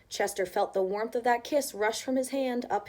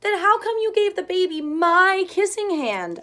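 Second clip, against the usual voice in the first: put-on high, louder hurt child question; Chester asking; 9 semitones higher, 8 dB louder than usual. Chester questions his mother, high loud hurt child voice. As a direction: press her, still hurt. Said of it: "asked Chester".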